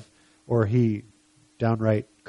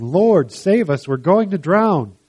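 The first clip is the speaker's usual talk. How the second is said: louder, higher cry for rescue; shouting; 7 semitones higher, 7 dB louder, slower than usual. Acted. Disciples waking Jesus in the storm, raised-voice shout. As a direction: call out for help within a read story.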